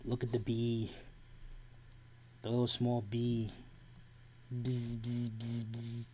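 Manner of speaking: soft, low cooing bee buzz. Pretend. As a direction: coo softly at a little bee.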